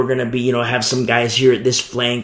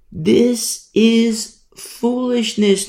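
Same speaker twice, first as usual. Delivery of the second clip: drawn out; 9 semitones higher, much slower than usual. Shown as a demonstration. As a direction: stretch this is foolishness out very slowly, higher. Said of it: "I can't emphasize it enough".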